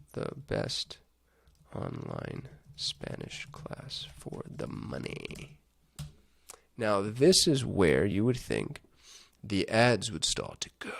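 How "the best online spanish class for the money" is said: slow, whispery murmur to yourself; very soft, drawn-out words; talking while typing. Real, not acted. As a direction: sound out a search query under your breath. Typing a search query aloud.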